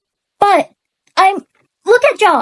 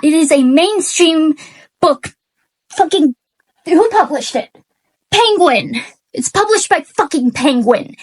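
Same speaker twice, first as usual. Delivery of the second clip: ordinary delivery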